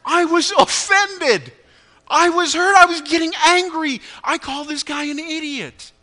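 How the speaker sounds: loud and high, slowing on each confession; heated, rising anger. Real, not acted. Pastor confesses his road rage to congregation, loud and heated. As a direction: let the hurt and anger pour out.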